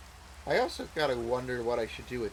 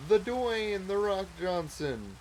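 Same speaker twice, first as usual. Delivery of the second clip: high, slow ring-announcer call; hype announcer; 7 semitones higher, 4 dB louder, slower than usual. Pretend. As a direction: drawl it out like a wrestling intro. Hyping rock as celebrity.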